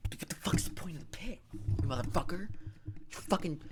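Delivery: a furious, breathy hiss of disbelief; whispered rant. Real, not acted. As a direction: seethe under your breath at the screen. Ranting at the movie's letdown.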